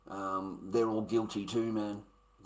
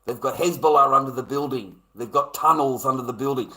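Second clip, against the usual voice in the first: mocking quote; 3 semitones higher, 9 dB louder than usual.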